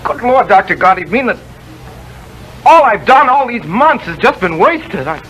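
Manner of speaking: put-on young scientist crushed to learn someone beat him to it; shocked protest. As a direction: fight the news, voice climbing in dismay. Discovery pre-empted, desperate protest.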